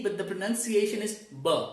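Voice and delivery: normal talking voice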